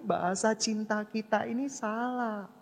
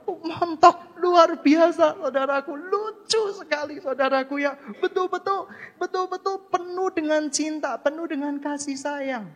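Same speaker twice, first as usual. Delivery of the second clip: much higher and louder, gushing with delight; delighted marvelling; 9 semitones higher, 6 dB louder than usual. Real, not acted. Marvelling at chubby baby.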